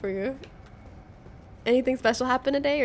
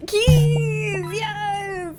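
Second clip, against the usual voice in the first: high joyful shout; excited cheer; 9 semitones higher, 5 dB louder than usual. Real, not acted. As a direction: cheer the find. Found keys.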